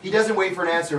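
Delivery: normal talking voice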